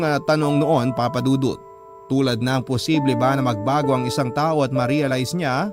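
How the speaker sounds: ordinary talk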